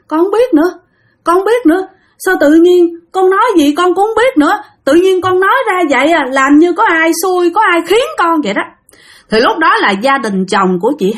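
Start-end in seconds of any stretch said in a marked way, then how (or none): 0.1-8.6 bewildered distress. A narrator's reading. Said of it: "Chị hai ngước lên".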